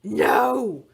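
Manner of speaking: loud, far-off growly monster refusal; monster roar. A narrator's reading. The monster refuses the princess, a loud roar. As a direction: roar it back like a stubborn beast.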